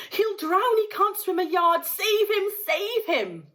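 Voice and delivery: high, loud frantic pleading; mother begging someone to save her drowning son; panicked scream. A narrator's reading. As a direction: beg for help in terror. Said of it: "screamed Missus Gloop".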